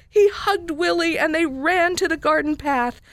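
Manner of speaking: lively storybook narration with big high character voices; reading a picture book aloud. A narrator's reading. Radio guest reads a children's book to listeners, animated storytelling. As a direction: read the story to the kids, bright and animated.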